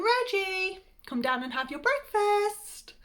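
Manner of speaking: mum's sing-out wake-up call. A narrator's reading. Mum waking Reggie for breakfast, calling out. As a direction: call him down, stretching the ends. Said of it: "when Mum woke me, calling".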